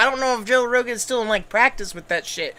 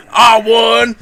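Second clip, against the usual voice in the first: mock victory cry; 8 dB louder than usual. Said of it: "and he's like".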